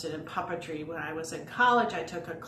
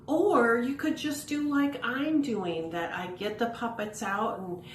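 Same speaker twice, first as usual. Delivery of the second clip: ordinary talk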